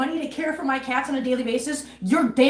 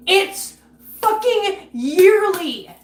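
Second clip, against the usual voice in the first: loud, slow, pitched-up fury; angry outburst; 7 semitones higher, 6 dB louder, much slower than usual. Real, not acted. Streamer furious that the fee comes every year, slow and loud. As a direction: spit out fucking yearly, slow and loud.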